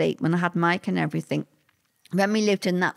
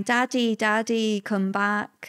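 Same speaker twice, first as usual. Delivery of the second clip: ordinary delivery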